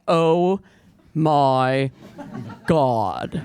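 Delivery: low, drawn-out oh my god; deadpan low freakout. Retold or imitated. Deep-voiced woman freaking out, low and slow. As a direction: freak out in a deep, flat rumble. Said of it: "I freak out. I'm like".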